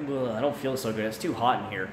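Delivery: quiet, lightly sick groan; a touch of nausea, played down; mock queasy. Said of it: "I almost got sick".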